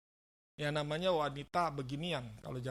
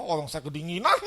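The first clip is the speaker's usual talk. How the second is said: laughing; 3 semitones higher, 10 dB louder than usual. Retold or imitated. Joking retold baptism, laughing.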